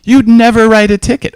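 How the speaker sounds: high, loud mock protest; objecting officers. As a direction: imitate cops objecting indignantly. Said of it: "here's what the officers always say".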